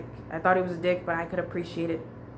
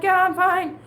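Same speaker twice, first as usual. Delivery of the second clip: fake fine; 12 semitones higher, 6 dB louder than usual. Lying about being fine.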